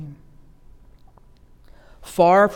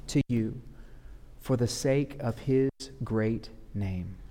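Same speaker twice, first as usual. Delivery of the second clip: softer, slow, gentle assurance; tender reassurance; 3 semitones lower, 7 dB quieter than usual. Acted. Preacher quietly assuring suffering listeners, tender and slow. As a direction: lay it gently on grieving hearts.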